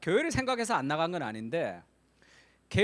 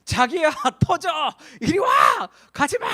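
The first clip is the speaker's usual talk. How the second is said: high loud panicked calls; wife begging husband to get away from the burning car; frantic shouting; 9 semitones higher, 10 dB louder than usual. Retold or imitated. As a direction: shout him back from the fire. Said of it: "자기 아내가 그렇게 얘기합니다".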